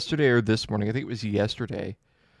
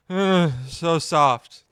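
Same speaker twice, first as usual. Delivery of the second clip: slow sleepy mumble; 4 semitones higher, 3 dB louder, much slower than usual. Retold or imitated. Retelling girlfriend's sleep talk, slow drowsy murmur. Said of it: "mumbles something and then says".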